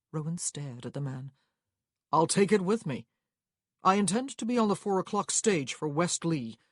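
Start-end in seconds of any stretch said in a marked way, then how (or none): 2.1-6.6 louder, firm traveller's voice; firmly voiced traveller, a little raised; determined traveller. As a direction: play a gentleman stating his plans. Narrator voices Rowan.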